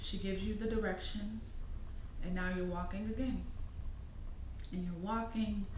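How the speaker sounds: soft guided-meditation narration, phrase by phrase; guided visualization. Acted. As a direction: guide the listener gently along an imagined path.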